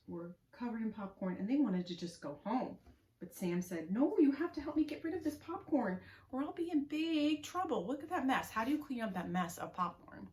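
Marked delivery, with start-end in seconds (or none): 3.9-8.5 pleading. Acted. Sam begs friends to help.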